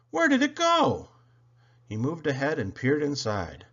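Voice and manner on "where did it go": high, loud startled question; Thomas, exclaiming. A narrator's reading. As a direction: cry it out in a raised voice. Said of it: "exclaimed Thomas".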